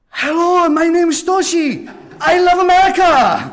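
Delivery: put-on very high, shouted accented greeting; imitating Toshi. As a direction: belt it out to strangers. Toshi greeting every passerby.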